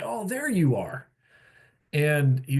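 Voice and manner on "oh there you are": higher, bright pleased welcome; lightly acted delight at spotting a friend; delighted greeting. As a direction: greet someone you're glad to see. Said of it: "other people walk into the room and say".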